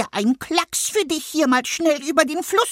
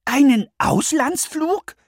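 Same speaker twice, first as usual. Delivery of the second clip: much higher, slow, astonished pup; 5 dB louder, slower than usual. Acted. Little dog asks the stork in amazement. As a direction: ask in amazement. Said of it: "fragte Nuschki erstaunt".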